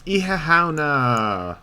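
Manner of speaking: slow, stretched Irish word; drawn-out word. Pretend. Streamer savouring the Irish word for Halloween, drawn out. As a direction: stretch "Ihahauna" out long and slow.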